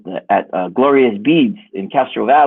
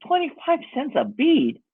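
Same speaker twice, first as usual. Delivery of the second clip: incredulous; 12 semitones higher, slower than usual. Retold or imitated. Outraged at bead prices.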